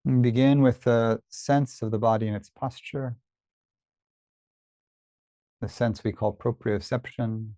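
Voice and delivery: unhurried body-scan guidance with long pauses; guided meditation. Real, not acted. Teacher leads listeners through a body scan, calm meditation voice. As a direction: guide attention gently, leaving space after each phrase.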